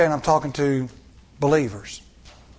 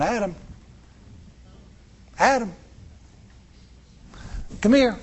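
calling out; 9 semitones higher than usual. Acted. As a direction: call Adam from across the garden. God calling Adam in garden.

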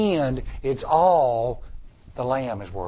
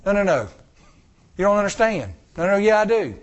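mock insistent; 6 semitones higher than usual. Acted quarrel continues.

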